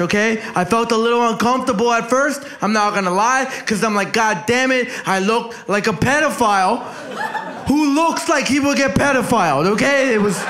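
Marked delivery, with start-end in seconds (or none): none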